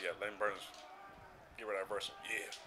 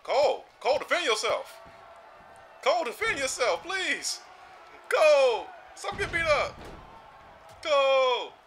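high, loud pleading calls to a teammate; urgent calling; 11 semitones higher, 5 dB louder than usual. Real, not acted. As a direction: yell at Cole to defend himself, high and loud. Begging teammate to fight back.

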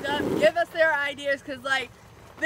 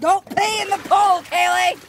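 high, loud scolding shout; loud yell; 6 semitones higher, 9 dB louder than usual. Real, not acted. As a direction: yell it across the pool. Yelling at sister.

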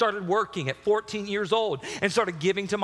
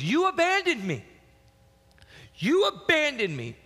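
retold scream; 10 semitones higher than usual. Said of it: "He starts screaming".

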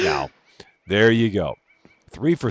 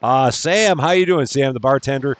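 louder and higher, a bright welcoming shout to a guest; cheery host greeting; 6 semitones higher, 5 dB louder than usual. Pretend. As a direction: play the eager club owner greeting a customer. Host greeting the bartender Sam.

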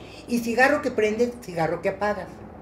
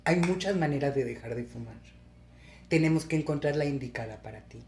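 calming doctor; 5 semitones lower, 5 dB quieter than usual. Retold or imitated. Doctor calming crying patient.

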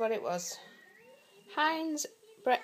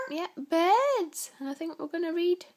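ordinary delivery

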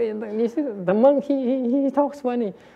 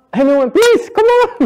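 much higher and louder, rising plea; playful begging; 7 dB louder than usual. Real, not acted. Teacher urging silent students to answer, high playful plea. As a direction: coax the room like you're desperate, half joking.

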